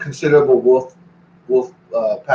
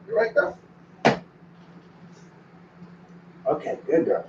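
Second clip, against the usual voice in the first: soft pet talk; 3 semitones higher, 8 dB quieter than usual. Real, not acted. Talking softly to the dog.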